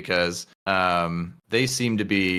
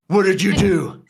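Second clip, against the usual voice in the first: put-on drunken accusation; 7 semitones higher, 4 dB louder than usual. Drunk patrons after tripping.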